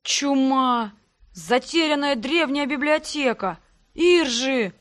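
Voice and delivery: blurted out with excitement; excited blurt. A narrator's reading. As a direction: burst out in delight. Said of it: "экспрессивно выпалил брат".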